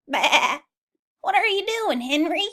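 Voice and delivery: high, loud, bleating sheep voices. Acted. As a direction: baa it out like curious sheep.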